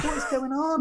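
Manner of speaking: high squeaky baffled question; mock bewilderment. Dad baffled by Easter trick-or-treaters, mock bewildered. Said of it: "but then you're like".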